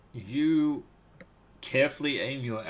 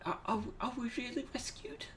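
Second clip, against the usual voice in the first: high, breathless whisper of a frightened captive; timid whisper; 11 semitones higher, 12 dB quieter, faster than usual. Acted. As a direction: whisper it high, barely daring to hope. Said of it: "when she's like".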